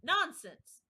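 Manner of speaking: Alice loudly refusing the queen; loud and decided. A narrator's reading. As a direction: fling it back decidedly. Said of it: "said Alice very loudly and decidedly".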